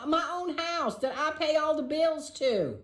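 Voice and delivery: high-pitched, drawn-out indignation; exasperated. Real, not acted. Host venting about feeling out of place in her own house, exasperated. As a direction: stretch it out high in aggrieved protest.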